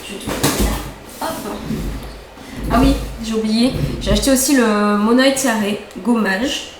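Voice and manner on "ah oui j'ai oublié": ordinary delivery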